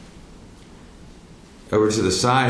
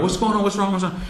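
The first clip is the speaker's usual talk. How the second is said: high-pitched, hurried mock alarm; retold alarm; 12 semitones higher, faster than usual. Nurses and doctors running into the room, mock alarmed questions. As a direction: imitate a flustered crowd bursting in.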